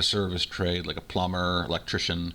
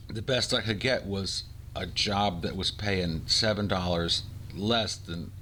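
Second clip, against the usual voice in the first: usual speaking voice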